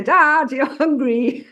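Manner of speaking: put-on high, loud drawn-out child's call; whining child. Hungry daughter interrupting dad at work, high whiny call.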